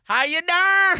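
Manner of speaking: high, loud, drawn-out pickup line; Joey catchphrase. Retold or imitated. Friend doing the catchphrase for Holly, high and loud. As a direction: ham up the cheesy flirt line. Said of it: "Miguel said it like that".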